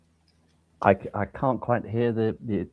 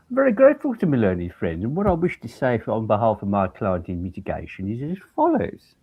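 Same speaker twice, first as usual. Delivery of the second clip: put-on Brummie accent. Defense counsel joining in the supposed joke, mock Brummie accent. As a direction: ham up a Birmingham accent as a barrister. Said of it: "deliberately putting on a Brummie accent".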